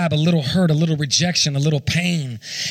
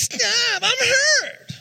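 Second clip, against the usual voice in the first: very high-pitched imitation of a crying boy; whiny child voice. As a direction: mimic a kid's screechy whine. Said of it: "whiny type voice".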